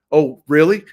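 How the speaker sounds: high, loud "oh really"; sarcastic surprise, thrown back; mock surprise. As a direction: act startled to turn it around. Retorting to a laughing friend.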